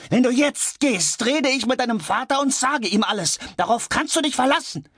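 very high, strained yelling; frantic high-pitched threatening; screamed threat. A narrator's reading. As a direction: shriek the ultimatum. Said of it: "schrie George".

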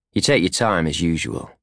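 ordinary delivery

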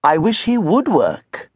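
slow, put-upon sigh of a schoolmaster; weary carpentry teacher. A narrator's reading. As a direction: drag it out like a teacher who has given up. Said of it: "the carpentry teacher had written".

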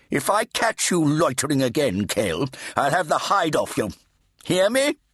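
put-on high, menacing old priest; threatening fat Redeemer. A narrator's reading. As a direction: warn the boy with a threat.

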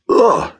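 high, loud shudder of disgust; sharp cry of revulsion; disgusted ugh. Acted. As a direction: spit out a revolted ugh. Narrator recoils from the crab.

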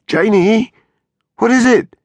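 desperate. A narrator's reading. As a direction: beg her to answer, frantic. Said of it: "he asked desperately".

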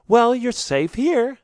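calm him, you're safe now; happy reassurance. A narrator's reading. Shavana happily reassures the exhausted stranger. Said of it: "Shavana says happily".

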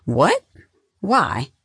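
high, startled questions; confused. A narrator's reading. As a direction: blurt each word in surprise. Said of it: "confused as to why".

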